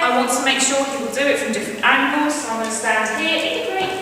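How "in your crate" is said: high, sing-song command to the dog; high-pitched cue sent to the crate; bright dog cue. Real, not acted. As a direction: call it up high and cheery for the dog.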